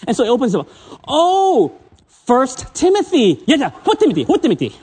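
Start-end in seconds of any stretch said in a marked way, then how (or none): 1.1-4.7 imitating Singlish friend. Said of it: "they got an accent".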